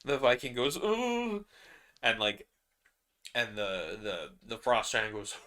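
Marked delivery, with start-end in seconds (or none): 0.8-1.4 squeaky startled gasp; a high yelp of surprise; high surprised oh. Acted. Viking reacting.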